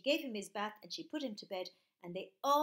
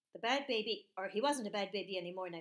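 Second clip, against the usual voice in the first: ordinary delivery, nothing marked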